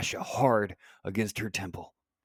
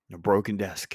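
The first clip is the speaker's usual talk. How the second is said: plain reading voice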